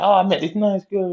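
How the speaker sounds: high, chirpy bragging; proud and clueless; naive boasting. Retold or imitated. Telling the mates about the girl, high and chirpy. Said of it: "And you're like".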